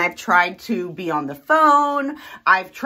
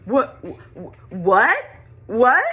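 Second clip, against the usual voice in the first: louder, rising what; stunned what; 3 semitones higher, 5 dB louder, much slower than usual. Retold or imitated. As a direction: sputter it in disbelief. Barista's stunned reaction.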